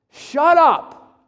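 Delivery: sudden loud high shout. Retold or imitated. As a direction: bark it at the crying kid. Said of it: "come out of his mouth to this kid crying".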